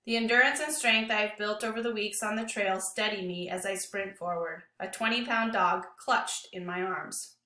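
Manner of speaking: plain reading voice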